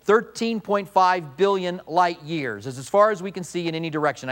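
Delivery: usual speaking voice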